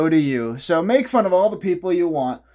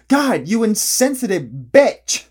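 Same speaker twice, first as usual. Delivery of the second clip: mock outrage; 7 semitones higher, 6 dB louder than usual. Mock outrage at a glasses joke.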